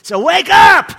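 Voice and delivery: full-voiced high shout; roaring wake up; shouted wake-up call. Real, not acted. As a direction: blast it at the congregation. Preacher shouts the punchline.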